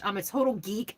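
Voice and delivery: ordinary delivery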